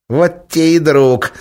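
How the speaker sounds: slow, high spiteful cat hiss; angry hiss. A narrator's reading. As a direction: hiss it out with malice. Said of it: "зло прошипел кот".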